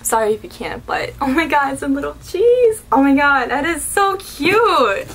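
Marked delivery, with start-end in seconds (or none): 1.2-5.1 high, loud gushing over something cute; delighted squeal. Real, not acted. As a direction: gush loudly, drawing out the last words. Delighted by a tiny cheese.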